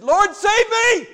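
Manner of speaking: loud, high, slow cry for help; desperate cry. Retold or imitated. Preacher voicing sinking Peter, crying out loud. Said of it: "he just said".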